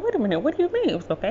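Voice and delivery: quick, high-pitched puzzled questioning; baffled mother. Retold or imitated. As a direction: imitate a mother thrown by the answer. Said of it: "she would be lost in the sauce".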